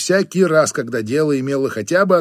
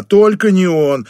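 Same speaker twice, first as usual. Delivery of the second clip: slow, louder, higher wail; 5 semitones higher, 5 dB louder, slower than usual. Acted. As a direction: wail it in dismay. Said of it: "взвыла она".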